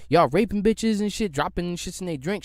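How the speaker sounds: ordinary talk, nothing marked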